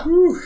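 relieved whew. Real, not acted. Relief after finishing challenge.

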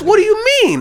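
very high mock disbelief; incredulous. Boss hearing about the sleeping driver, incredulous. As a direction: question it in a high, startled voice. Boss reacts in retold argument.

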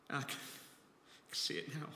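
hushed, slow, breathless whisper; moved whisper. Real, not acted. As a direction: whisper it, overcome with awe. Tearful whisper recalling Jesus' face.

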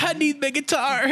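high, pleading cartoon voice; skinny guitarless hero. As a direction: beg like a shrunken rocker. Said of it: "He's just like".

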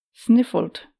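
ordinary delivery, nothing marked

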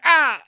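loud, high yelp; imitated pain cry. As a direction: yelp as if kicked in the kidney. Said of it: "kicks him in the kidney".

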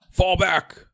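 high, loud battlefield shout; shouted command. Acted. Sergeant Cyclops ordering the troops back, shouted command. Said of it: "is going to shout".

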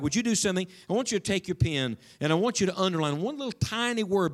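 ordinary delivery

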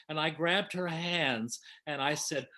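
usual speaking voice

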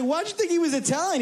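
high, amused, incredulous question; amused disbelief. Retold or imitated. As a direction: pastor laughing at a friend's mix-up, asking in amused disbelief. Pastor retells asking an amused question.

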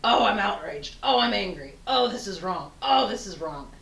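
mock the perpetually offended; hammed-up indignant moaning; mock outrage. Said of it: "when you're constantly".

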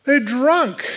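high, drawn-out mock scoff; flippant jeer. Flippant onlookers scoffing at the believers, high mocking jeer. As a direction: play scoffers dismissing it, pitched well up. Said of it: "the flippant people saying".

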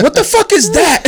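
high-pitched imitation of outraged yelling; hammed-up, high indignant shout; mock yell. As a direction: yell it like an old man going off. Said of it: "like, yelling".

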